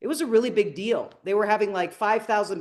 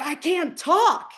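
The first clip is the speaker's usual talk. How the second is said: high, drawn-out panicked realization; hammed-up distress; acted anxiety; 8 semitones higher, slower than usual. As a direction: replay your own panic, slowly and high. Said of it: "I hit anxiety".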